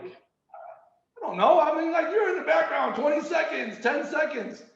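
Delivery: high, shouted, rattled retelling; flustered groom retelling the live TV countdown, high and shouting; frazzled countdown. Retold or imitated. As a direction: relive the panic of the countdown. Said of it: "I'm like".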